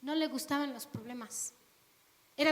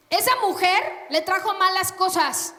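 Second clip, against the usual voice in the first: louder, pointed blame; loud accusing gossip; 6 semitones higher, 9 dB louder than usual. Acted. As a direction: blame the foreign wife loudly. Gossip blaming wife.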